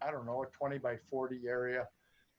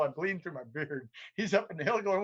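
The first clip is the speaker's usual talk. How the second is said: ordinary delivery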